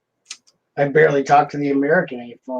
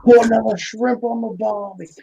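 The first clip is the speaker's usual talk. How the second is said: higher, hammed-up Aussie twang on "Put another shrimp on the Barbie"; jokey Australian accent, pitched up; mock Australian accent; 7 semitones higher than usual. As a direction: play a cheesy Australian tourist ad line. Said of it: "maybe my Australian accent".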